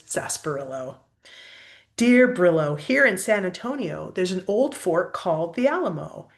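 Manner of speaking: rhythmic rhyming postcard read; cheerful letter reading; reading to children. Acted. Reads postcard aloud.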